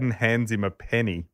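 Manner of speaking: ordinary delivery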